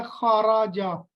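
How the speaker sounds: خاراجہ said slowly, each syllable spelt out clearly; careful pronunciation. Shown as a demonstration. Teacher modelling the correct reading of خاراجہ, said slowly. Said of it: "میں ایک دفعہ تو آپ کو صحیح بتا دیتا ہوں".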